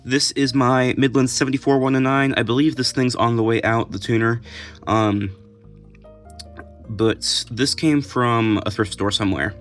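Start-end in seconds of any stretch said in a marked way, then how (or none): none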